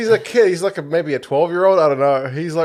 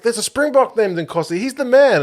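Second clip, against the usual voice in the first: excited; 9 semitones higher, 3 dB louder than usual. Retold or imitated. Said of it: "I was like".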